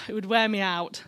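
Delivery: normal talking voice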